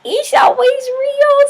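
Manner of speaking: hammed-up high-pitched repeat after a laugh; playful squeal.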